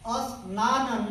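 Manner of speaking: स्नान said slowly as asnaan with a short a in front; a before sn. Shown as a demonstration. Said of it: "उसके पहले हम अ का प्रयोग करते हैं".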